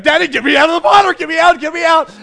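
high, loud, fast panicked pleading; imitated frantic kid's scream; screaming child. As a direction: shriek like a terrified little boy. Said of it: "so he starts screaming".

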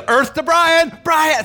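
high, loud mock call across the hall. Wife calling husband to stage.

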